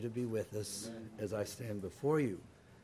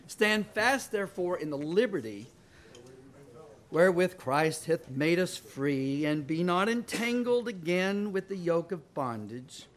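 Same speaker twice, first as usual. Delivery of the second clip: forceful reading; 4 dB louder than usual. Real, not acted. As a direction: proclaim the verse boldly to steady yourself. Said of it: "maybe that will help me not be emotional".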